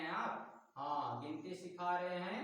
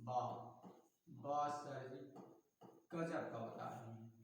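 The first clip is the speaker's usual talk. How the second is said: awed student reply; 6 semitones lower, 6 dB quieter than usual. Pretend. Student's amazed reply to teacher.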